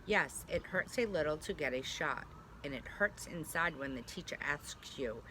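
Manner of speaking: normal talking voice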